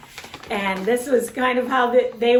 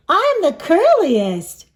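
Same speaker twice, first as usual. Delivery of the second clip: proud character brag; 7 semitones higher, 7 dB louder, slower than usual. Acted.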